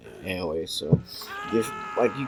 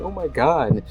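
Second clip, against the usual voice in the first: startled; 6 semitones higher than usual. Retold or imitated. Imitating woman's startled reaction.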